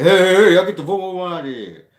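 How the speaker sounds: high, loud scolding parent. Shown as a demonstration. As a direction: yell like a parent catching a kid. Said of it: "your parent voice".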